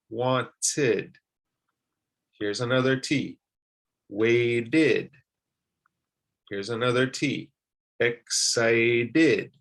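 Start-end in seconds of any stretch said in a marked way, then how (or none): 0.1-1.2 "wanted" split as WAN-TID; "wanted" with a full "tid" ending; extra syllable. Shown as a demonstration. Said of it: "you add an extra syllable". 4.1-5.1 "waited" stretched into WAY-DID; "waited" with a clear "id" at the end. Shown as a demonstration. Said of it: "it's something of an id sound". 8.0-9.6 "excited" drawn out as EX-CI-DID; "excited" with a clear "id" at the end. Shown as a demonstration. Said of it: "it's something of an id sound".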